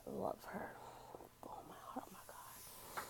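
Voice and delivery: soft, voiceless whisper full of love; tender whisper. Real, not acted. To viewers, about her newborn daughter, a tender whisper. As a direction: let it fall to a near-silent whisper of love.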